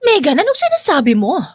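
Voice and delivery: feigned surprise. A narrator's reading. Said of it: "Kunwaring nagtataka".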